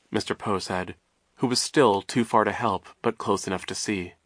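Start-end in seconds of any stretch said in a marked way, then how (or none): none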